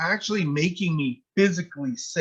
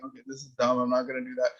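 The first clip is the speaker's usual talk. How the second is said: muttered aside; 4 semitones lower, 7 dB quieter, much faster than usual. Retold or imitated. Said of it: "And I'm like".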